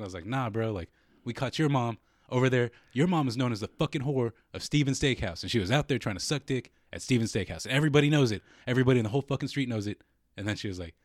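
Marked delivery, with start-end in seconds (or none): 0.3-10.0 louder, higher, building accusation; emphatic mock tirade; retold trash talk. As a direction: re-enact a public roasting with rising force. Re-enacts his tirade at the neighbour.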